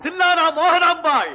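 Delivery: very high, drawn-out proclamation; sustained high celebratory shout; shouted acclamation. Acted. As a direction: cry the title out slowly to the crowd. Echoes the title in acclaim.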